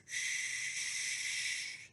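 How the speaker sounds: soft unvoiced shush, no tone at all; gorilla's shush. Gorilla hushing the zookeeper so she won't wake. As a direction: breathe the shh out like a secret. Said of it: "And he's like".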